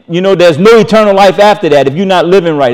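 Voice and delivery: ordinary talk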